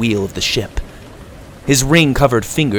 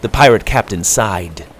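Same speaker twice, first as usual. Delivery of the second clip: ordinary delivery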